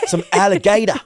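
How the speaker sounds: high, hammed-up Aussie voice; amused mock-Australian twang; Australian accent. Offering Aussie food to the co-host, Australian accent. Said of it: "you've got very good Australian accent".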